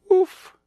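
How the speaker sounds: high little bark; puppy woof. Acted. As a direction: yip like a tiny puppy.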